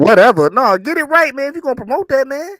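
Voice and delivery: loud high teasing scold. Real, not acted. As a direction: playfully yell at him. Mock-scolds co-host, loud and high.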